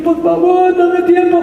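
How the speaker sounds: high-pitched wailing plea; hammed-up tearful begging; mock bawling.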